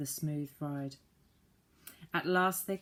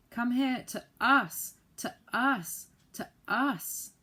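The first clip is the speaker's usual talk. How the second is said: high, loud, slow pleading shout; despairing shout; 7 semitones higher, 6 dB louder, slower than usual. A narrator's reading. Joe desperate to get the saucepan man back, shouting high and loud. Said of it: "shouted Joe in despair".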